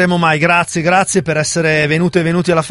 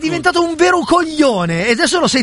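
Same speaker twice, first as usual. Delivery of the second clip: higher-pitched exasperated scolding; infuriated; 7 semitones higher than usual. Pretend. Host scolds co-host for being his old self, infuriated. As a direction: tell him off, pitched right up. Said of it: "mi fa infuriare".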